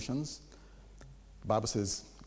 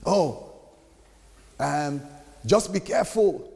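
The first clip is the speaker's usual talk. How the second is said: limp anticlimactic warning, higher and louder; mock prophet; 4 semitones higher, 6 dB louder, slower than usual. Preacher mimicking a false prophet's weak warning. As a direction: imitate a prophet fizzling out. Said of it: "So what next?".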